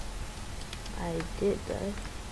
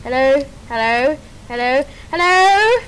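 repeated calling out; 5 dB louder than usual. Real, not acted. Calling teammate who isn't answering.

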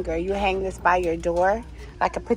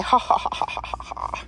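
mock evil laugh. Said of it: "That's what it would say".

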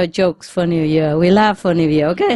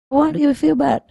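higher, quizzical ask; curious passerby; 10 semitones higher than usual. Retold or imitated. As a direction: ask with surprised concern. Passerby asks.

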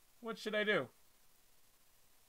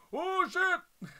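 high loud startled curse; shocked yell; 13 semitones higher, 7 dB louder than usual. Acted. Boy waking in the other body, startled high yell. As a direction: jolt awake in panic.

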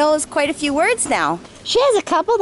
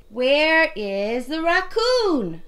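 put-on reading to a toddler; 4 semitones higher, much slower than usual. Mother naming pictures with toddler.

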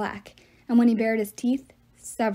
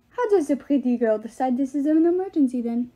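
put-on higher, louder French-accented question; fake French accent; 3 semitones higher, 4 dB louder than usual. A narrator's reading. Love god tests Piper's claim, higher French accent. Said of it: "he had a French accent so bad".

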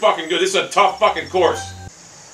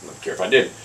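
normal talking voice

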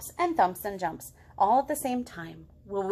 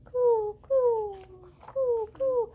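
dove coo; 11 semitones higher, slower than usual. Shown as a demonstration. Teacher cooing like a dove for the class, high. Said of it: "don't forget to coo".

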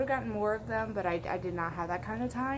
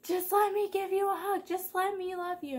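much higher, pleading mock voice; clingy friend; 11 semitones higher, 3 dB louder than usual. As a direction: beg for a hug in a high squeaky voice. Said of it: "I'll be like".

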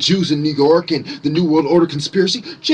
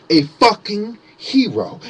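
ordinary delivery

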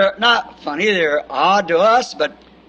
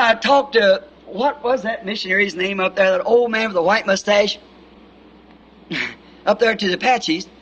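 ordinary talk

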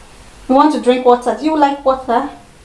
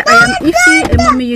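shouting; faster than usual. Shown as a demonstration. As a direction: belt the name out like a cheer. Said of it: "off shouting".

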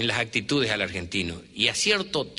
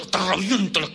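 mock threat shout; 6 semitones higher than usual. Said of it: "Te grito".

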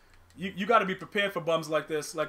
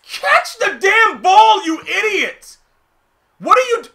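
slow, much higher, very loud yell; furious shout; 14 semitones higher, 14 dB louder, slower than usual. Real, not acted. Streamer yelling at his receiver over a drop, furious shout. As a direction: scream at the receiver in rage.

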